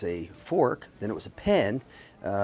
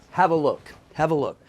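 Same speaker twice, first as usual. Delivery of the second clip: ordinary talk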